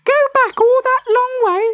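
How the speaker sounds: put-on very high baby tortoise voice, aghast; a tiny baby protesting the long trip. A narrator's reading. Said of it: "said the baby".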